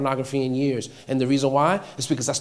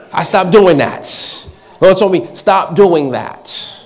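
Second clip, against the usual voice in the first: loud, higher, driving pulpit delivery; emphatic, raised-voice sermon; forceful preaching; 4 semitones higher, 8 dB louder than usual. Real, not acted. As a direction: preach it hard with ringing emphasis.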